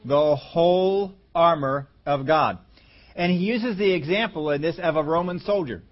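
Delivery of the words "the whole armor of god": slow, separated words with "whole" held long; emphasis on WHOLE. Shown as a demonstration. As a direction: lean hard on "whole", pause, then "armor of God". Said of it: "the emphasis is on".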